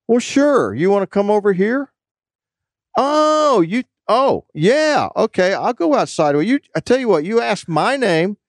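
imitated escalating argument. Debt collector squaring up to someone on the phone, mock-combative. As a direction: rise well above your voice, goading. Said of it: "and it escalated".

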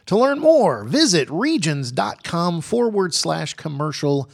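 slow, emphatic ad read; radio ad read. Acted. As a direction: sell it like a radio spot. Reads sponsor ad.

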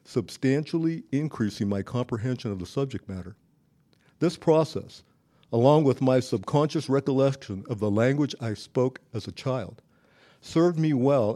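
usual speaking voice

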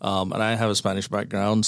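ordinary talk, nothing marked

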